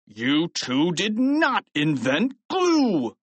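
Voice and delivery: understated shout, slow and drawn out; mildly voiced outrage, each word stretched; angry shout. A narrator's reading. Principal contradicting the boys about glue, understated angry shout. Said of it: "he shouted".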